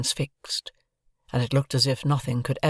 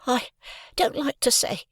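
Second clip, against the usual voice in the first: stammering; 11 semitones higher than usual. A narrator's reading. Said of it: "I stammered".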